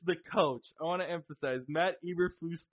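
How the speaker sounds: ordinary delivery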